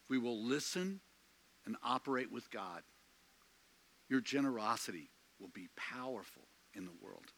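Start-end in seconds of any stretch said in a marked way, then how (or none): none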